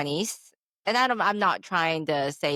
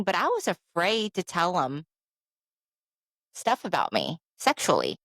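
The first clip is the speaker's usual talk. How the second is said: ordinary talk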